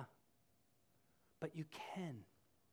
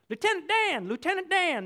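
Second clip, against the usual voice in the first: higher, louder excited call; Forrest Gump calling; 9 semitones higher, 8 dB louder than usual. Retold or imitated. Forrest calling to Lieutenant Dan, higher and louder. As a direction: call out to the friend you love. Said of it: "this guy he loves".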